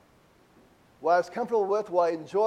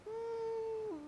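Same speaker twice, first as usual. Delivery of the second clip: knowing hum; 14 semitones higher, 15 dB quieter than usual. Acted. Rhetorical question to congregation.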